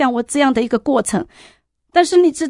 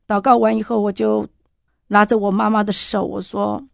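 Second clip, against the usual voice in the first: ordinary delivery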